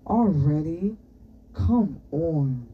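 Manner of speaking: groaned, put-upon complaint; groaning protest. A narrator's reading. Boy hears who arrived today, groaning. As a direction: groan it out in reluctant disbelief. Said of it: "Orlu groaned".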